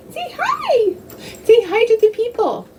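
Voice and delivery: high sing-song coaxing for a pet. Real, not acted. Talking to her little dog, high coaxing baby talk. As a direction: coax the dog in a high cooing voice.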